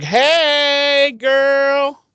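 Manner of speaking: high sing-song sassy greeting; a fake-friendly purr; sassy Glory. Glory sidling up to Tara on the bench, high and sassy.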